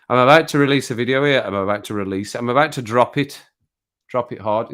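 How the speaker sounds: ordinary talk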